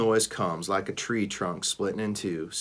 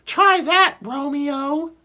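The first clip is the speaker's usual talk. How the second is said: lightly voiced high-pitched character, much higher; a squeaky teasing character, higher and louder; 7 dB louder, slower than usual. A narrator's reading. Teasing the hero in a high, louder voice.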